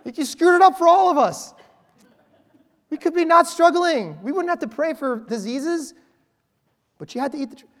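high mock-frustrated complaint; fed up with Adam and Eve. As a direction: blame them for ruining it all. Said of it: "I'm like".

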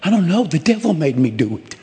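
mock-innocent confessor passing the blame; sheepish excuse. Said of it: "You're like".

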